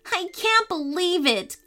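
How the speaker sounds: put-on high, thrilled boy voice; grinning delight. A narrator's reading. Caleb meets Mickey, grinning and thrilled. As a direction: say it high through a grin. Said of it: "Caleb grinned".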